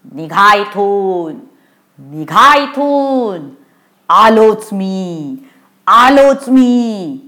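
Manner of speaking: slow, wailing ghost call; ghostly warning. Acted. Ghost in the tree warns the children off, slow spooky call. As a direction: draw every word out, the repeat pushed harder. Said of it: "झाडामधून पुन्हा आवाज आला".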